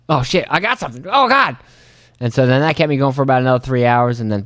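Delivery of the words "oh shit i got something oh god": startled excitement. Retold or imitated. Fish bites unexpectedly.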